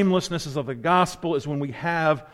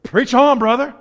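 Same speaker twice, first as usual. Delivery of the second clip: loud call of encouragement from the pews; 5 semitones higher, 6 dB louder than usual. Real, not acted. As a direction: shout it back to the preacher. Congregation member calling out encouragement.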